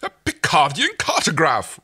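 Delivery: slow, loud, high exclaim in a put-on royal voice; thrilled royal gasp; enthused. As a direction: gush over the treasure. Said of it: "Rigel enthused".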